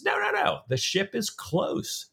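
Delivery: high-pitched mock protest, slowing at the end; lightly acted high-voiced insistence; insistent. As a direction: wave it away insistently, pitched well up. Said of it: "but Stephen insists".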